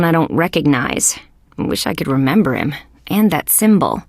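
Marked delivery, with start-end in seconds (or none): none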